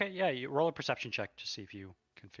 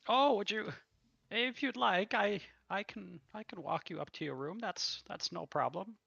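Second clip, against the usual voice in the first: higher, eager waitress voice; helpful tavern waitress, raised pitch; 11 semitones higher than usual. Acted. Waitress offers help.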